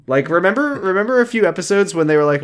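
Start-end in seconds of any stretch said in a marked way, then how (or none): none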